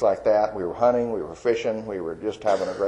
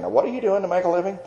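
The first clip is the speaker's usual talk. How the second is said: quick, much higher voice of worried parents; parents asking; 10 semitones higher, faster than usual. Retold or imitated. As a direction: rattle it off high, as the anxious parents. Parents questioning the struggling artist.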